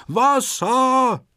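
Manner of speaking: very high, drawn-out storybook scream. A narrator's reading. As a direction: cry out in high-pitched alarm. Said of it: "Antonio zappelt und schreit". (hoher, lang gezogener Schrei)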